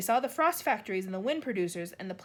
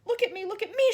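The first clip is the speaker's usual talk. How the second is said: high, loud gleeful shout; the freed centipede shouts to the others; excited shout; 10 semitones higher, 7 dB louder than usual. A narrator's reading. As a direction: shout it out in delight. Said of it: "shouted the centipede excitedly".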